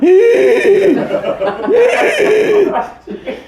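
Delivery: very high squeal with wheezing; high-pitched mouth-made tank noise; imitated oxygen tanks. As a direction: mimic the front row's hissing breathing gear. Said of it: "over the first row going".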